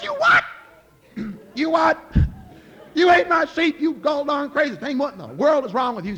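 high, loud outraged shout at Jack; furious king. Acted. King shouting at Jack for eating his sheep, high furious yell. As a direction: roar it out like a king who just lost a sheep.

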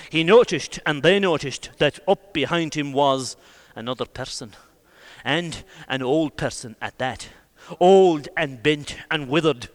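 ordinary delivery

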